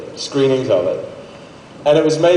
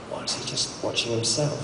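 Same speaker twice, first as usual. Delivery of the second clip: breathy, much softer whispering with a laugh in it; whispered mutter; 12 dB quieter than usual. Retold or imitated. Moviegoers whispering about the actor in their row, hushed and mocking. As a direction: whisper it like a snide cinemagoer. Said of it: "I heard sort of".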